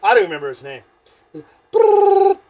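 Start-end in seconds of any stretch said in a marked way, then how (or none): 1.7-2.4 long, high, loud trilled ring; phone ringing. Retold or imitated. Imitates phone ringing on speaker.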